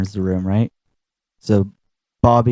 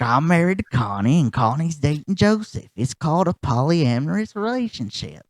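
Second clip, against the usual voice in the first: higher, much softer matter-of-fact Bobby; plainly explaining, trailing softer; Bobby Hill; 5 semitones higher, 4 dB quieter than usual. Retold or imitated. Bobby explaining polyamory to his dad, softer.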